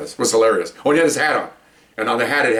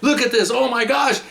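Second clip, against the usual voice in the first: high-pitched, thrilled exclamation; excited chief; 11 semitones higher than usual. Retold or imitated. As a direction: imitate a chief beside himself with excitement. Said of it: "he was so excited".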